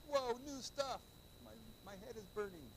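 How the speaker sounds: high mock-student voice; overwhelmed student. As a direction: play a student boggled by new material.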